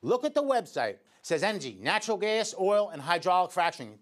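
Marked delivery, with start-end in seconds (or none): none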